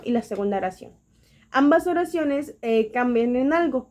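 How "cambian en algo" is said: ordinary delivery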